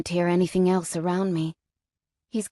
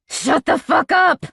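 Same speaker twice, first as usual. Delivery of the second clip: villain roars at his screaming victim; roaring; 7 semitones higher than usual. A narrator's reading. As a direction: bellow it at the screaming captive. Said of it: "he roars".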